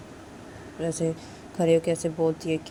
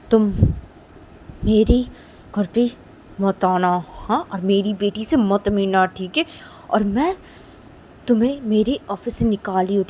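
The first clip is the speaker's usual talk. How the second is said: loud, higher, furious telling-off; angry scolding; 3 semitones higher, 9 dB louder than usual. Acted. Rich woman angrily banning the girl from her house. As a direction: throw her out with fury. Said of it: "PU बोलती है रूही को".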